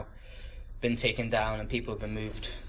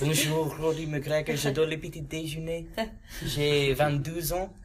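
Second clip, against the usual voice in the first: put-on recited school French with a French accent; schoolkid French; 4 semitones higher than usual. Rattling off school French to the group, French accent. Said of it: "Good accent".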